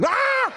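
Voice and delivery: short high-pitched startled cry; loud mock scream; startled yelp. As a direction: yelp as if suddenly shocked. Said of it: "I didn't scream".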